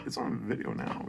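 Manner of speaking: ordinary talk, nothing marked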